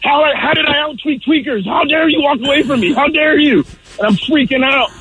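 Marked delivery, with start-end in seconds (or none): 0.0-3.6 high-pitched retold outrage; worked-up, much higher voice; mad, angry. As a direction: act out indignant ranting at people walking away. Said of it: "I get mad, like, angry".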